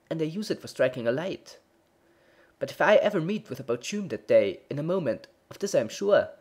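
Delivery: lightly voiced anxious baker; fretful baker. A narrator's reading. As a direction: confess the dread nervously. Baker tells his dread.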